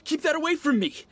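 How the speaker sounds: high-pitched alarmed outburst, imitating him; retold shout. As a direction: act out his freak-out, pitch jumping way up. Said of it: "He shouted".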